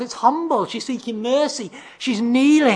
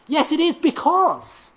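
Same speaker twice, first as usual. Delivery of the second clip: feisty; 8 semitones higher, slower than usual. Retold or imitated. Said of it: "her words are more feisty than that".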